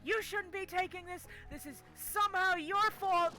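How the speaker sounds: red-faced doctor shifting the blame; angry blaming. Retold or imitated. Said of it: "gets all red in the face".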